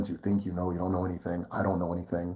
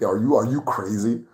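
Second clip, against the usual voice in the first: ordinary delivery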